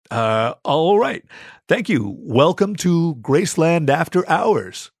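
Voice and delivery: recover, then welcome the guest grandly; awkward welcome. A narrator's reading. Guard recovers to welcome special guest.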